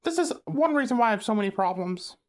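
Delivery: loud exasperated outburst; frustrated rant. Real, not acted. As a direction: vent it, fed up and loud. Exasperated rant about annoying people.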